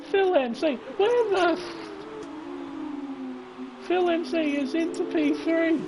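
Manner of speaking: high, startled commentator cry; shocked disbelief. Real, not acted. Commentator seeing a leader drop out, shocked and high-pitched. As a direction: react in stunned amazement, pitch way up. Rival suddenly leaves the race.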